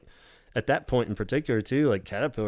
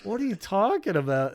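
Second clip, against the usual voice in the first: higher, lightly bewildered Robert; 8 semitones higher than usual. Retold or imitated. Robert silently wondering at her tale, lightly bewildered. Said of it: "you can tell he's just like".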